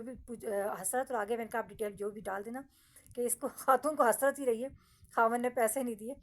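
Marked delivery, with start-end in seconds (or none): 1.6-6.2 loud insistence. Retold or imitated. Dictating her epitaph to husband.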